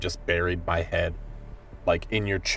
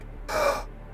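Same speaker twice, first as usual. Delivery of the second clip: big audible breath, released as a sigh; deep breath sighed out; 5 dB quieter than usual. Acted. Kanan steadying before going on, deep sigh. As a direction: draw in deep, let it out voiceless. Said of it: "a deep breath and is like".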